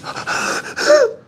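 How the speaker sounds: high, breathy crying gasps; whispered crying. Retold or imitated. Imitating the frightened cellmate, whispered crying gasps. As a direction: gasp in like a frightened sob. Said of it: "I hear this whispering, but, like, crying".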